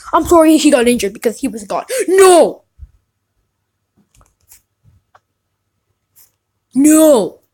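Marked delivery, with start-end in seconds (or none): 2.1-7.4 loud cries of disbelief; excited no. Real, not acted. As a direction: shout no in shock. Disbelief at pull.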